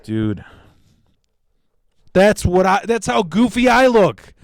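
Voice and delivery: exasperated retort. Retold or imitated. Exasperated reply to goofy name.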